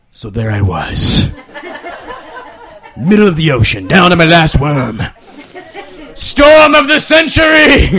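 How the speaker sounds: hammed-up adventure narration; mock epic storyteller. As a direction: tell it like a tall fishing yarn. Waiter spins mock epic tale.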